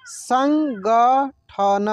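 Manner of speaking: संगठन stretched slowly, syllables apart; slow syllable reading. Shown as a demonstration. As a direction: sound out संगठन slowly for learners. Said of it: "जैसे जैसे मैं पढ़ रहा हूँ ना". (संगठन को धीरे धीरे अक्षर अलग करके)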